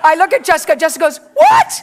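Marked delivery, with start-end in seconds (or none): 1.3-1.7 very high, loud rising yelp; shocked disbelief. Retold or imitated. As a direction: imitate a friend's startled outburst. Jessica reacts to the news.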